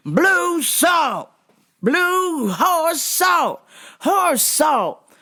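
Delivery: mock customer yell, high and drawn out; imitated yelling. Customer to worker four feet away, yelling. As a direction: bellow each word like he can't hear himself. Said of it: "lower your voice".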